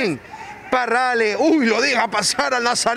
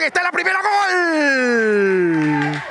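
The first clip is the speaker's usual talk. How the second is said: goal shout; 4 semitones higher, much slower than usual. Real, not acted. Goal called in live commentary.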